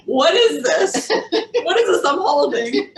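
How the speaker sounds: laughing, high and loud; giggling through the question. Real, not acted. Laughing holding rutabaga.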